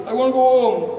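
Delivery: put-on high, loud drunken plea; befuddled old drunk. Lost old fellow in the bog wanting home, plaintive. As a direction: wail it lightly like a lost old drinker. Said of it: "who's the worse for wear".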